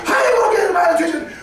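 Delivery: fast, high, shouted question; loud shout. Shown as a demonstration. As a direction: show them what a loud voice sounds like. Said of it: "That's why you have a loud voice".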